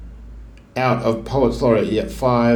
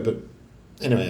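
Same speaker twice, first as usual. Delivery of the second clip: ordinary delivery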